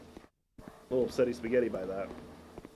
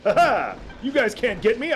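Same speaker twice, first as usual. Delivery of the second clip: high, loud gloating shout; gleeful taunt; 9 semitones higher, 6 dB louder than usual. Real, not acted. Gamer taunting enemies from behind a door, gleeful shout. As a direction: crow at the enemies as if you're safe.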